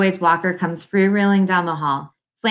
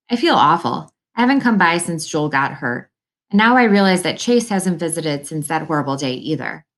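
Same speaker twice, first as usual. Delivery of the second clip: ordinary delivery, nothing marked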